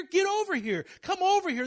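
a preacher booming God's call to come over. Acted. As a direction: beckon them over with force. Preacher voicing God calling people.

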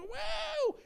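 very high whoop of joy; living-room touchdown whoop; cheering woo. Retold or imitated. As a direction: whoop like a fan on the couch. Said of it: "we go".

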